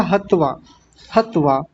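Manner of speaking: "हत्वा" short, with one त; correct single consonant. Shown as a demonstration. Teacher modelling for students, single त in "हत्वा". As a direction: say "हत्वा" as hat-vaa, no held t. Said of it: "बोलेंगे क्या?".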